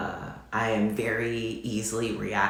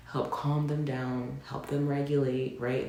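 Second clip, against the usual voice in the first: ordinary talk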